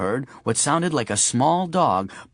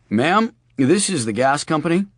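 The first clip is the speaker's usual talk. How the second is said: lightly voiced official phone manner; a hint of a professional caller; gas company voice; 3 semitones higher, 3 dB louder than usual. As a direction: sound like a utility worker. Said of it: "in his best gas company voice".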